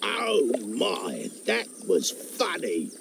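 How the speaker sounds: frog chuckling after a laughing fit; delighted frog. Acted. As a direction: gasp it out, still tickled with laughter.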